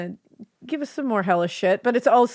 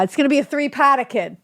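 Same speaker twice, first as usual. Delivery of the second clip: put-on fast, loud Boston accent, higher; hammed-up Boston accent; 6 semitones higher, 3 dB louder, faster than usual. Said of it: "Sorry, I didn't mean to be so proper about it".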